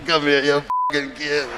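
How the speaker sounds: put-on old gangster reminiscing about a hit; Mafia accent. Mobsters swap murder stories, low Mafia accent. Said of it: "his accent is killing me".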